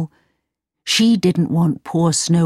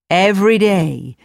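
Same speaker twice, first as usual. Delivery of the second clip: ordinary delivery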